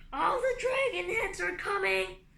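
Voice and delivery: high, loud defiant bellow. Acted. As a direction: roar the song at them. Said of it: "Tsunami bellowed even louder".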